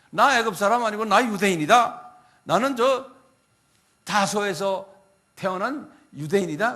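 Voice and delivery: loud, firm self-declaration; Paul's loud declaration. Retold or imitated. Paul answering the Roman commander, loud and firm. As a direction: proclaim it proudly. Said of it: "바울이 말하기를".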